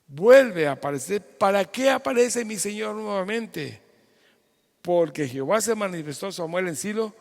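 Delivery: preaching at full force. Real, not acted. Pastor proclaiming scripture to online congregation, loud preaching.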